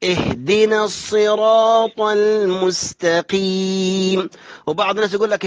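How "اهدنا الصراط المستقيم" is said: slow measured tajweed chant; Quran recitation. Shown as a demonstration. Teacher models correct verse, slow tajweed chant. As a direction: recite slowly with long held vowels. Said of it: "اهدنا مجزوم".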